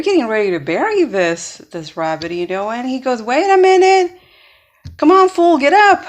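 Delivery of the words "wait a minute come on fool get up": loud, high-pitched call to get up; impatient shout. Retold or imitated. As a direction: yell at someone faking it, acted out. Fox yells at dead rabbit.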